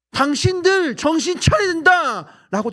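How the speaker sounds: loud, high, slow preacher's shout; shouting at inmates. Retold or imitated. Preacher shouting at hundreds of inmates, loud and high. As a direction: bellow it at the crowd of prisoners. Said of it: "제가 막 소리칩니다".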